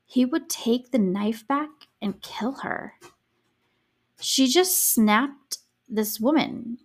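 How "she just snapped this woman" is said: slow emphatic. Real, not acted. Emphatic verdict on Susan.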